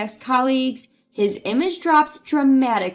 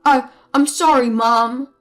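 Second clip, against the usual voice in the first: louder stammering apology as the husband; cowed husband; 6 dB louder than usual. Acted. Husband apologizing to his mother, stammering. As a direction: apologize like a scolded son.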